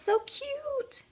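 high, slow coo; drawn-out high cute. Real, not acted. Cooing over charm.

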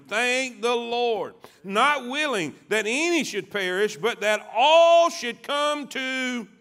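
loud scripture with long, drawn-out key words; full-force preaching. Real, not acted. Preacher quotes verses on salvation to congregation, full-force preaching.

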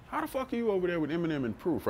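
high, fast, incredulous blurt; stunned disbelief. Retold or imitated. Rapper hearing his producer met [name], high disbelieving blurt. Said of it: "And I'm like".

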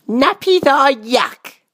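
put-on high, slow little-girl voice; high-pitched child character; little princess. A narrator's reading. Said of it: "said the little princess".

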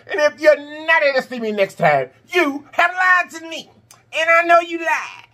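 playful fake tears at viewers who skip; mock crying wail. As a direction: pretend to be heartbroken for laughs.

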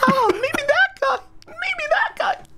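very high squeaky voice, slow. Acted. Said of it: "Is that your real voice".

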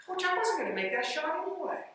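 a hint of a sheepish young player owning up; player's sheepish reply. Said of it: "he goes".

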